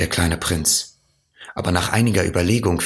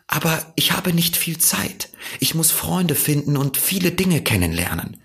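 higher, earnest little prince; 11 semitones higher than usual. A narrator's reading. Little prince tells the fox he lacks time, earnest. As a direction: voice the prince explaining himself, higher.

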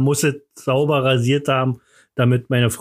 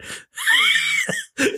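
shrill giggle, pitched way up; high squealing laugh. Real, not acted. Laughs at own joke. (quietschendes Lachen)

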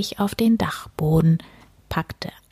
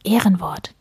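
ordinary delivery

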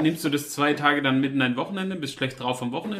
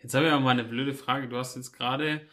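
usual speaking voice

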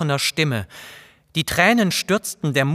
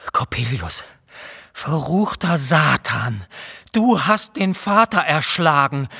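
a mild, understated outcry of grief and rage; anguished accusing cry. A narrator's reading. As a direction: accuse the devil over father's body. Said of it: "so schrie ich auf".

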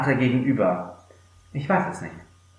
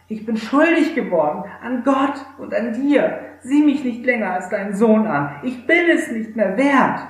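higher, begging son's voice; pleading confession; 9 semitones higher than usual. Acted. The son confessing to his father face to face, pleading. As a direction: throw yourself on his mercy. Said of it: "sagte der Sohn".

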